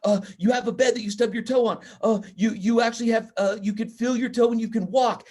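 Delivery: put-on high, loud excited kids' answers; eager kids. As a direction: blurt it out like eager students. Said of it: "three hands pop up".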